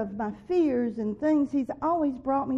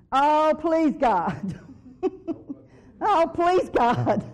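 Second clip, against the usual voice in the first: loud, high begging cry to God; pleading prayer; 3 semitones higher, 6 dB louder, much slower than usual. Retold or imitated. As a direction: beg God out loud, half-laughing at herself.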